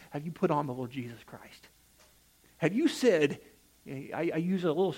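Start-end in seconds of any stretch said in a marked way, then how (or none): 0.1-1.7 whisper. Real, not acted. Preacher's earnest appeal.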